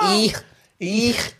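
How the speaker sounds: high Scouse throat scrape; Liverpool accent. Shown as a demonstration. Friend warming up for the challenge, Liverpool accent. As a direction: exaggerate the Scouse k sound. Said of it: "We'll go Liverpool".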